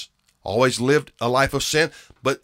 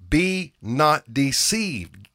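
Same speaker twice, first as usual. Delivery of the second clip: slow solemn scripture quote; much slower than usual. Acted. Preacher quoting the Apostle Paul.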